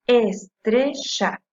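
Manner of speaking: estrella very slow, in separated beats es, tre, lla; syllable by syllable. Shown as a demonstration. Teacher giving an example of graves, estrella slowly split. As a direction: draw estrella out with weight on TRE. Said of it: "es la penúltima".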